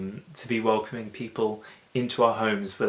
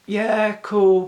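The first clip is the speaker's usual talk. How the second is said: breezy brush-off; 10 semitones higher, 9 dB louder than usual. Retold or imitated. Invited people brushing God off.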